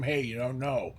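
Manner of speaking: ordinary talk, nothing marked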